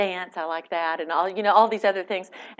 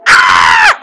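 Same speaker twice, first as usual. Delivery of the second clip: shocked cry; 17 dB louder than usual. Retold or imitated. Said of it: "and I went".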